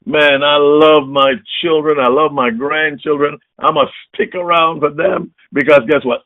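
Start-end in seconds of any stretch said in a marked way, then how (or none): none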